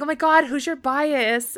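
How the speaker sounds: retold eager question to a new concert friend; excited fan. Fan asks seatmate her favorite, imitated excitement.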